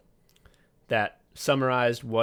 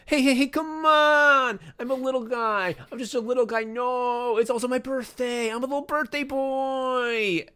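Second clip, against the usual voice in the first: high little guy voice; 14 semitones higher, 4 dB louder than usual. Retold or imitated. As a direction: squeak it out like a harmless little guy. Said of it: "my ex would go".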